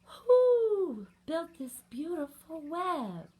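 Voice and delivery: slow, high question with a hooted "who"; owl character. A narrator's reading. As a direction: draw it out loudly as the owl. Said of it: "asked the owl".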